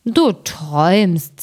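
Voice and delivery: slow, sleepy giant dismissing it; half-asleep giant brushing it off; second giant. A narrator's reading. Sleepy giant tells his companion he is dreaming, slow and drowsy. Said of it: "sagte der andere".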